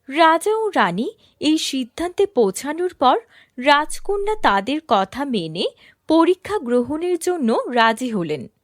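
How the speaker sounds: ordinary delivery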